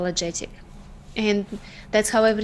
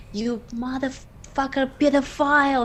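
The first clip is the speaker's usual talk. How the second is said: mom yelling at her abuser in the street; mama bear screaming at the pedophile; retold yelling; 4 semitones higher than usual. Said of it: "she was yelling, like, in the whole street".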